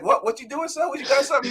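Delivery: high-pitched mock confrontation; mock challenge. Host plays Aemon standing up to a rival, mock challenge. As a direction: square up to someone, pitched well up.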